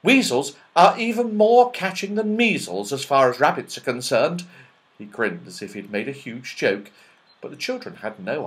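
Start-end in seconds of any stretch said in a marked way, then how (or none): 0.0-4.4 high, loud elf voice; chirpy little elf apologising. Acted. Elf apologising to the children, high loud elf voice.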